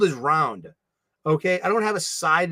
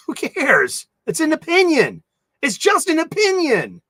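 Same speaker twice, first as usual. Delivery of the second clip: high, raised-voice mock exasperation; mock outrage; 8 semitones higher, 5 dB louder than usual. Answering petty gossip about others, high mock-exasperated shout. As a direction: cry it out in exaggerated dismissal. Acted reply to drama gossip.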